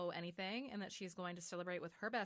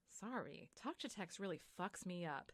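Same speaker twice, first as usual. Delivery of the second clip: normal talking voice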